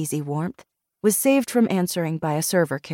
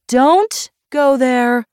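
loud, high, drawn-out warning; ordering; 8 semitones higher, 7 dB louder, much slower than usual. A narrator's reading. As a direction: cut her off with a slow, emphatic command. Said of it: "Barbara ordered".

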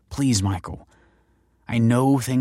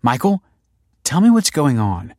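higher, louder insistent demand; 6 semitones higher, 6 dB louder than usual. A narrator's reading. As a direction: demand an explanation at once. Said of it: "demanded Kelsey".

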